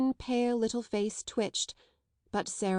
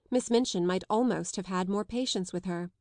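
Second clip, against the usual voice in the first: ordinary delivery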